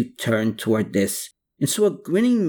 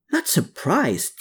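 high, mild yelp; 8 semitones higher than usual. A narrator's reading. Man correcting her remark, mildly yelping. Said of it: "he yelped".

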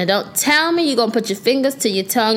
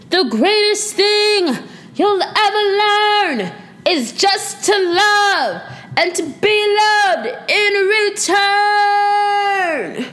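high, slow, belted-out movie line; hammed-up movie quote; 11 semitones higher, much slower than usual. Podcaster acts out the Moulin Rouge quote, hammed-up movie delivery. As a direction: declaim it theatrically, stretching each phrase high. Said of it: "that's why I said it like that".

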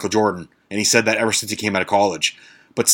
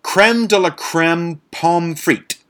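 slow, higher, hammed-up French accent on the dish name; mock French accent; 6 semitones higher, slower than usual. Naming the fancy fries to viewers in a mock French accent. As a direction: pronounce it like a snooty French waiter, mock French accent. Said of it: "a little French accent there".

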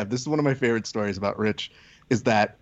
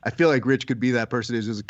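usual speaking voice

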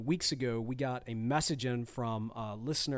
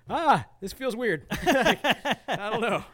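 awkward reaction; 9 semitones higher, 7 dB louder, much slower than usual. Retold or imitated. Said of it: "was kinda like".

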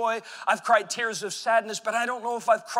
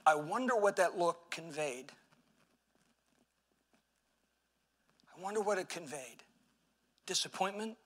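hushed wondering; 2 semitones lower, 7 dB quieter than usual. Real, not acted. Preacher ponders Jesus' look, soft and halting. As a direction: let each word hang quietly.

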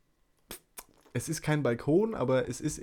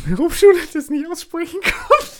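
laughing; 11 dB louder than usual. Real, not acted. Laughing while telling story.

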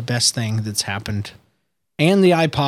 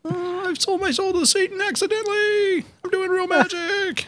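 high squeaky mock voice; 4 dB quieter than usual. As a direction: squeak it out like a cartoon. Mock voice of magician guy.

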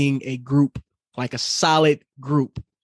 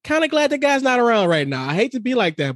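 much higher, hammed-up imitation; mock inner voice; 10 semitones higher than usual. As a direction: squeak it out, half mocking. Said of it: "just like".

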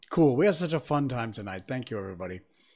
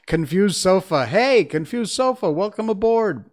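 excited greeting; 9 semitones higher, 11 dB louder than usual. Real, not acted. Greeting a new viewer.

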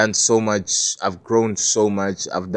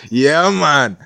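Jamaican cheer; 3 dB louder, much slower than usual. Retold or imitated. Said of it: "all the other guys are like".

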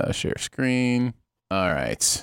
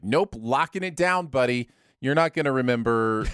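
stubborn brain voice; 3 semitones higher than usual. Acted. Brain refusing to give up an actor's name, mock stubborn. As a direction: play a smug brain locking the door. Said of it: "your brain's like".

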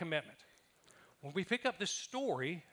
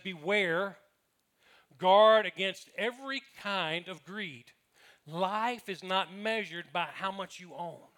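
solemn scripture reading; 5 dB louder, much slower than usual. Acted.

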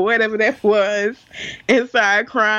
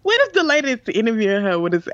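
ordinary talk, nothing marked

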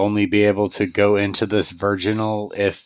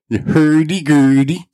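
higher, louder mock Swedish chef with a rising sing-song on hurdy-gurdy; 7 semitones higher, 7 dB louder than usual. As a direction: ham up the Muppets' Swedish chef, bouncing hurdy-gurdy upward. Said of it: "try to do my best Swedish chef voice".

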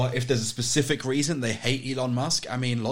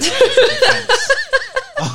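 loud, high burst of laughter; high shrieking laugh; 10 dB louder than usual. Real, not acted. Laughing at the spies' alibi, loud high shriek.

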